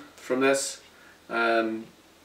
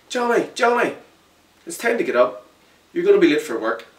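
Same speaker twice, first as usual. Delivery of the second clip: put-on much higher mum waking her boy; mother rousing her son for work; mum's voice; 8 semitones higher, 6 dB louder than usual. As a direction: call him up like a busy mum.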